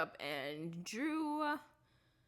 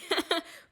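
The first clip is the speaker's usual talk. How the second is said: short high laugh; 12 semitones higher, 6 dB louder than usual. Real, not acted. Laughs at Lucy crying.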